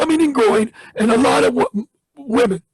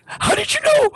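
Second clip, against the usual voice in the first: high astonished cry of disbelief; astonished, much higher. Retold or imitated. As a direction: voice leaps up in stunned wonder. Man astonished at the prophecy.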